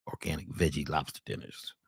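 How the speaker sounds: low, mocking repeat of the menu; mock deep repeat. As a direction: sneer the words back, deep and dry.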